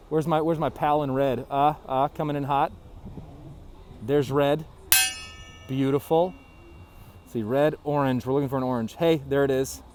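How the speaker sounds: normal talking voice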